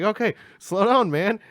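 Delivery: high, loud urgent plea; anxious plea. Retold or imitated. Urging driver, louder.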